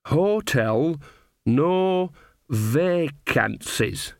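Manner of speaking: very slow, halting reading of foreign words; muttered sounding-out. A narrator's reading. Nanny Ogg puzzling out a foreign hotel sign, muttered slowly. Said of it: "she muttered".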